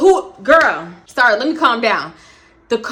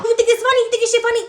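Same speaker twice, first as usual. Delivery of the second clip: much higher, shrill-edged imitation of her yelling; mock furious yell; 10 semitones higher, much faster than usual. Girlfriend confronting Boney, mock furious yell. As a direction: scream it at him like she's squaring up.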